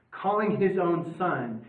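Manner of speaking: ordinary talk, nothing marked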